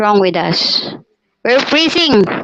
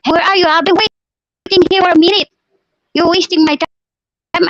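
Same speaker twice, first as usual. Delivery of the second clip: imitating his angry yell, higher pitched; a retold, higher-pitched angry yell; 8 semitones higher than usual. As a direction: mimic an impatient boyfriend shouting at her. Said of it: "that man yelled".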